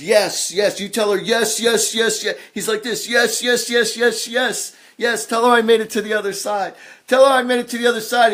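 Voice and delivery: high, loud and eager yes yes; fast, high, loud cries of yes; excited yes. Retold or imitated. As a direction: channel the spirit's eager yelling. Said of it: "He goes like this".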